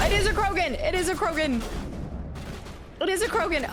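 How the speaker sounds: it really is the Krogan, alarmed; alarmed realization. Real, not acted. Streamer spotting the Krogan, alarmed realization.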